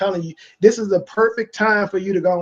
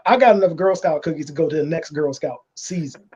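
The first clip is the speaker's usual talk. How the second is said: usual speaking voice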